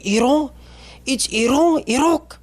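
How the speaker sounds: Iran and Iraq high-pitched, as "ee-RON" and "ee-ROK"; mock-correct "Iran", very high, long open o; exaggerated native pronunciation. Comic mocking over-careful pronouncers of Iran, exaggerated native pronunciation. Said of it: "you don't have to go".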